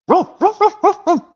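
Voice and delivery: very high, loud yappy barks; dog barking impression. Puppy barking at home, high yappy barks. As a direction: bark like a small excited dog. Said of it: "how about this one where it goes".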